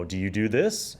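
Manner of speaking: ordinary talk, nothing marked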